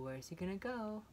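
plain reading voice

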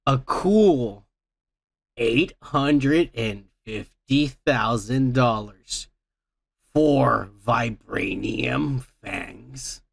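slow, low reveal of the price; deadpan slow emphasis; dramatic slow reveal. Real, not acted. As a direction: drag out each word for effect.

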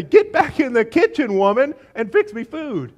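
loud, high, hammed-up command; mock bossy order. Preacher parodies ordering a woman to the kitchen, mock bossy. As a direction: boom out a comically domineering order.

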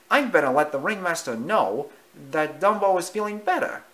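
high, busy little mouse. Acted. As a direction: hurry off politely. Timothy voiced.

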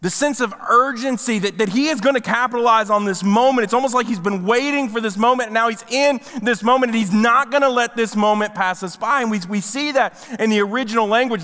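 ordinary talk, nothing marked

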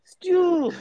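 high call of a name. Retold or imitated. Host mimicking a character calling for Stu, high call.

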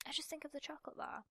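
breathless secret whisper, fast; whispered aside. Real, not acted. Gamer whispering a confession about the chocolate bar. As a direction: let it slip as a quick whispered confession.

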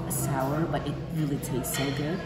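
ordinary talk, nothing marked

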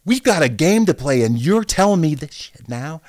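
loud, high angry retort; angry teammate. Retold or imitated. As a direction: fire back in disbelief. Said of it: "He's like".

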